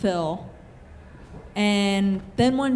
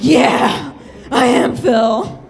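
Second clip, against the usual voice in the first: smug; 2 semitones higher, 7 dB louder, much slower than usual. Retold or imitated. Smug reply to boyfriend.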